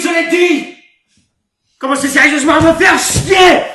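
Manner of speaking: high, full-volume shouting rant; yelling, worked-up outburst; furious tirade. Acted. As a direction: rage at the whole cast. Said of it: "J'aime pas m'énerver".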